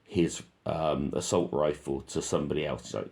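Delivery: ordinary delivery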